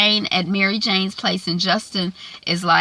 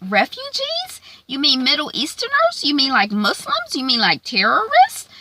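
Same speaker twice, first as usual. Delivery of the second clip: higher, mock-alarmed questioning; incredulous anchor; 7 semitones higher than usual. Anchor reacting to refugee workers on air, mock alarmed and higher. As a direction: play the shocked host leaning in.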